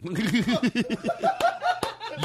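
high, drawn-out laugh. Acted. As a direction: laugh in a long high run. Laughs during mock interrogation.

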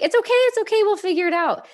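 hint of a husband calming her down; husband reassuring. Husband calms her on phone.

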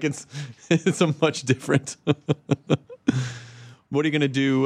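ordinary talk, nothing marked

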